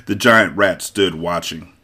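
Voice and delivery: plain reading voice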